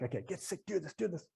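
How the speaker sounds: hushed, urgent inner pep talk; will yourself on under your breath; soft, pressing self-coaching; urging oneself. Retold or imitated.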